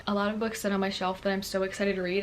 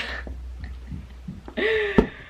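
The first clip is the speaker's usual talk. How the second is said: high giggle; 12 semitones higher than usual. Real, not acted. Laughs at her own contradiction.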